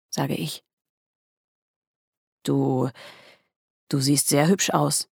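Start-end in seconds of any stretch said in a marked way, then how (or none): 2.4-5.1 shy compliment, slightly lowered voice; halting, mildly bashful; embarrassed. A narrator's reading. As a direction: hesitate after the first word, a little embarrassed. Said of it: "sagt er verlegen".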